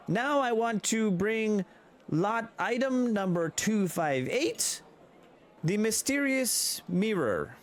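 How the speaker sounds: high, slow showman announcement; auctioneer. Acted. Auctioneer presenting the mirror to the room, slow and high. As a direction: present the lot grandly, slowly.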